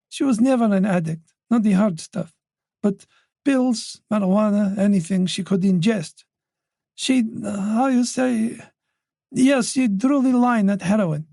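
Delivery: put-on an elderly mother, voice lifted by worry; anxious old mother voice. Wife explaining.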